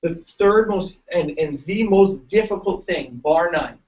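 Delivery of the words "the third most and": usual speaking voice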